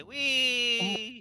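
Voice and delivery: gleeful wee. Acted. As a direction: stretch out a happy flying whee. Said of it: "that's why I was saying, "Wee!".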